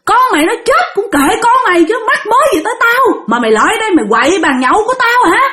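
high, dismissive husband's retort; acted-out heartless scolding, pitched high; callous anger. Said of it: "rất là vô tâm".

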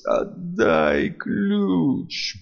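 very slow, dragged slurred demand; tongue stumbling over each word; slurred speech. Acted. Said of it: "заплетающимся языком произносит Саладорец".

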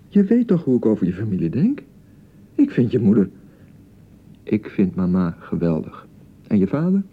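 ordinary talk

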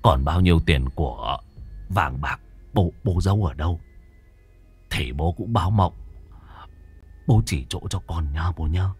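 low, soft murmured plea to a dead father; hushed fearful prayer. Acted. Son praying to his dead father, hushed and fearful. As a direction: whisper a nervous prayer at the altar.

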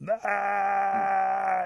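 long high loud "aah"; hollered wail. A narrator's reading. Rabbit keeps hollering from sticker bushes, mock wail. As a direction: sustain a put-on howl. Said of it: "He keeps hollering".

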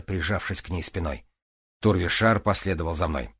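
ordinary delivery, nothing marked

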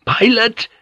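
high, disbelieving question; choked out. A narrator's reading. Drunk straining to see his friend, choked out. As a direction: force it out in a strangled high voice. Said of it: "he choked out".

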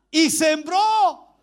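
loud, high, drawn-out proclamation; llamado a viva voz. Real, not acted. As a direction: call it out loud and long to the congregation. Prompts congregation to shout names. (proclamación fuerte y alargada)

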